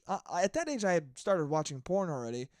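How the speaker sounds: ordinary talk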